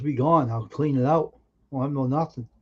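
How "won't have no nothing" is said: normal talking voice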